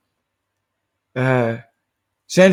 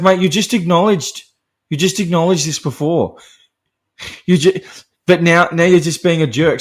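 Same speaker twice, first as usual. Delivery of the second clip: louder, higher scolding rant; exasperated scolding; 6 semitones higher, 5 dB louder than usual. Real, not acted. Reviewer scolding a film character, loud and fed up. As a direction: tell him off like a mate who knows better.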